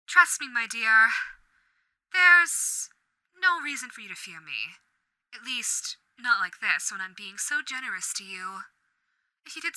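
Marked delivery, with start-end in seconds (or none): none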